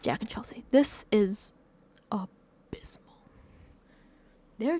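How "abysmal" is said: slow, disgusted whisper. Real, not acted. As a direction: breathe it out in disbelief. Judging the lost team.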